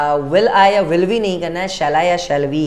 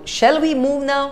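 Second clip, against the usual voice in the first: slow and higher, teaching voice; slow raised teacher demo; slow model sentence; 8 semitones higher, much slower than usual. Shown as a demonstration. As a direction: lay it out word by word. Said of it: "next is".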